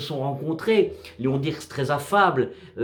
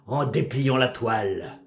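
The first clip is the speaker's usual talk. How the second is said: slow, solemn verse reading with measured pauses; poem recitation; 3 semitones lower than usual. Acted. Narrator recites a sea poem about a drifting wreck, measured recitation. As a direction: recite it as poetry, line by line, falling at the ends.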